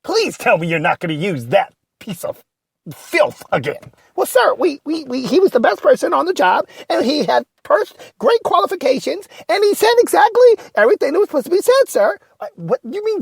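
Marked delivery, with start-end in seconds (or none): 4.2-12.2 higher-pitched, nervous yes-man voice, speeding up near the end; a jittery assistant pleading with his boss; timid sidekick Ray. Acted.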